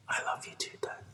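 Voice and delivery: put-on sleepy child's whisper. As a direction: breathe it half asleep. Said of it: "he whispers back".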